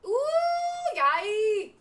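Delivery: very high, loud whoop of joy; shrieked, delighted celebration; excited squeal. Real, not acted. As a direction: cheer at the top of your voice. Cheering progress in the game.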